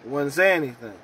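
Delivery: usual speaking voice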